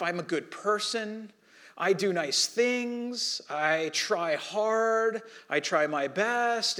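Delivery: slow, drawn-out self-justifying voice; mild mock slow pleading excuse. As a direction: stretch each excuse out slowly.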